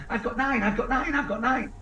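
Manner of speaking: absurdly upbeat after losing a finger; mock cheerful. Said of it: "they go".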